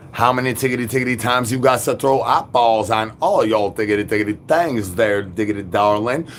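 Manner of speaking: usual speaking voice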